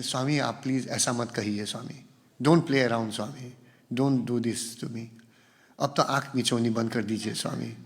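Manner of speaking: soft, melodramatic film-dialogue plea to the guru; filmy devotional plea. Retold or imitated. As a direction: plead softly like a film heroine. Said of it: "More dialogues".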